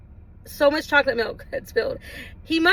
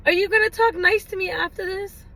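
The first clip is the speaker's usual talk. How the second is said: put-on cute child plea; 6 semitones higher than usual. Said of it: "it was just so cute".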